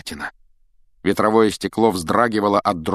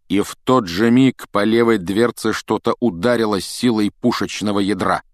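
ordinary delivery

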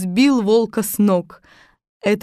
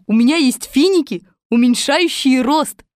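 higher, slightly louder helper voice; eager Little Muk; 6 semitones higher, 5 dB louder than usual. Acted. Little Muk to the captives, eager. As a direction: pipe up with an idea.

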